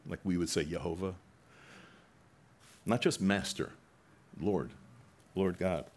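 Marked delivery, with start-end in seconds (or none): none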